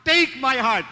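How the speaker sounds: loud, high shout for the congregation to copy; shouted demo. Shown as a demonstration. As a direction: show them how to yell it out. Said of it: "sisigaw kayo". (ipinapakitang sigaw para gayahin ng mga tao)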